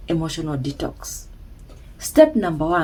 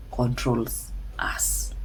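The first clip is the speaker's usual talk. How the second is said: hushed whisper; 7 semitones lower, 9 dB quieter, much slower than usual. Real, not acted. Dramatic point in motivational talk.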